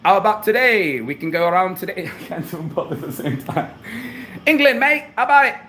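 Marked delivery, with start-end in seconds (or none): 0.0-2.1 showing off an English accent; mock English accent, lively. Shown as a demonstration. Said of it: "I got a good English accent, too". 4.5-5.6 loud, high-pitched mock English accent; hammed-up English accent. Shown as a demonstration. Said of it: "I got a good English accent, too".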